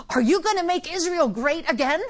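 a hint of an eager disciple asking; lightly voiced hopeful disciple; mock disciple. Disciples asking Jesus, lightly voiced eager disciple. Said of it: "They might have said".

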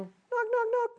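tiny, high-pitched knocks; little bitty knock. Retold or imitated. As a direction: squeak the knocks out small and high. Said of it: "little bitty knock at my door".

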